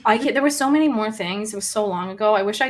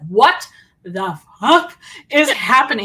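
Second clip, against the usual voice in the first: loud disbelief; 8 dB louder, slower than usual. Retold or imitated. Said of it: "I openly, audibly".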